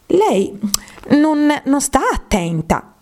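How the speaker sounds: high, stern scolding mouse, slowed. A narrator's reading. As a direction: scold her for not listening. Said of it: "disse severamente il topo ad Alice". (rimprovero severo, acuto)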